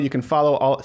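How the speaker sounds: ordinary talk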